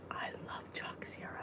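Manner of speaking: gasp, then whisper it with delight; hushed thrill at a favourite; excited whisper. Real, not acted. Gasps at favourite brand.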